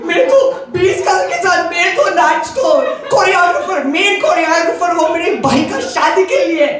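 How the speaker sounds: very high, very fast tearful-comic pleading in Hindi; Bollywood heroine plea. Retold or imitated. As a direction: plead like an over-the-top Bollywood heroine, laughing. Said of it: "cue the Bollywood music".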